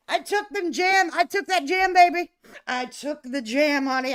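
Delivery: high, loud, gleeful crowing; triumphant boast. Real, not acted. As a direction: crow it like you won big. Triumphant boast about taking the jam.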